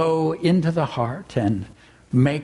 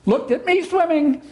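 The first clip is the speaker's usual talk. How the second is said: squeaky Roo; 13 semitones higher than usual. A narrator's reading. Roo voiced again.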